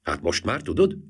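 ordinary delivery

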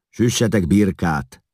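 loud, high command; giving orders. A narrator's reading. Eszlász orders food from the servants, commanding and loud. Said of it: "rendelkezett Eszlász".

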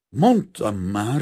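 loud, slow, exasperated reproach; panting reproach. A narrator's reading. Father panting in after the painter, reproachful. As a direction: push it out slowly and loudly, winded. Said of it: "lihegte az áruház tulajdonos".

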